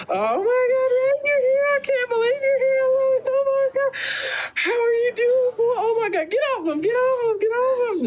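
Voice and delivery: very high, frantic gushing; overjoyed high-pitched babble; excited squealing. Acted. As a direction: squeal over him like a whole mob of doting women. Mob of women greeting Rain.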